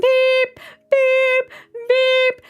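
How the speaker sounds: high loud mock beeping; imitating the microwave keypad beeps. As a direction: beep like pressing microwave buttons. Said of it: "and then I go".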